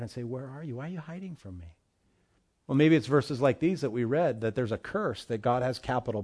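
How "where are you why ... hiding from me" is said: very soft, intimate questioning; Holy Spirit's gentle voice. Acted. Holy Spirit speaking to your heart, hushed and tender. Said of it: "the Holy Spirit, he can speak to your heart".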